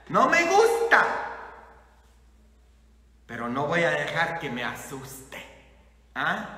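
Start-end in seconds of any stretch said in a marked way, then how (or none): 0.1-1.0 loud, high, emphatic protest; firm, raised-voice refusal; defiant declaration. Acted. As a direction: face it and say it out loud. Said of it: "Enfrenta tus sentimientos y dile".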